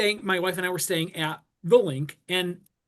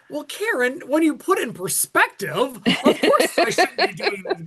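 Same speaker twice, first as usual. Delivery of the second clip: higher-pitched mock-reasonable protest; trying to be logical; 7 semitones higher than usual. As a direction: plead logic, with a laugh creeping in. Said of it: "trying to be logical".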